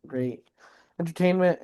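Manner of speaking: ordinary delivery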